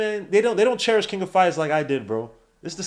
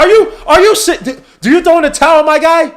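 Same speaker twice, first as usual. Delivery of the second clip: much higher, louder disbelief; incredulous shout; 11 semitones higher, 12 dB louder than usual. Real, not acted. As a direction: yell in total disbelief. Friend flubs a game name.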